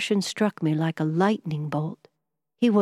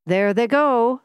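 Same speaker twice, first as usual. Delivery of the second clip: higher, louder call-out; calling out in suspense, raised voice; excited cry; 5 semitones higher, 5 dB louder, slower than usual. A narrator's reading. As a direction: cry it out at the sight. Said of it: "cried Mister Harland".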